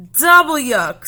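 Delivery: loud, high groan of disgust; a child's over-the-top grossed-out yell. Acted. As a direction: spit out the yuck, grossed out. Disgust at packed eggs.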